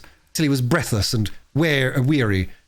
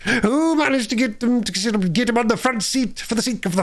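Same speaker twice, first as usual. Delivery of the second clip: gloating Toad; 9 semitones higher than usual. A narrator's reading. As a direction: revel in your own genius.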